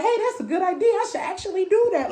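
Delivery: high-pitched acted lightbulb moment; sudden realization. Hearing your own advice and taking it, voiced as a keen realization.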